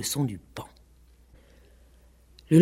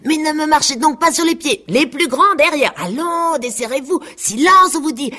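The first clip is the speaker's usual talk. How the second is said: high, loud barking orders at a crowd; a haughty peacock calling the jostling animals to order; bossy peacock shouting; 12 semitones higher, 4 dB louder than usual. A narrator's reading. Peacock shouting order at the crowding farm animals, high and loud. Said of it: "faisait le paon".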